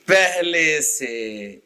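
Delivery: slow, low teacher's prompt; very drawn out. Shown as a demonstration. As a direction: stretch each syllable right out. Teacher spells out the answer slowly.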